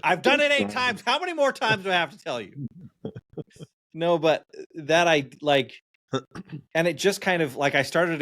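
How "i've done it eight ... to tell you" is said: mock exasperation. Parent pretending to snap at a kid after the eighth time, exasperated. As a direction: throw up your hands, ham up being fed up.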